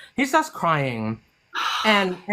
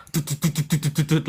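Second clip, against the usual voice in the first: rapid-fire syllables for running feet; mimicked patter of someone running; imitated footsteps; 3 semitones lower, faster than usual. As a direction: tap out quick, even, low steps with the voice. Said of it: "loud footstep behind me".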